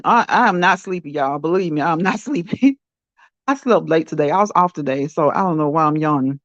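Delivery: ordinary talk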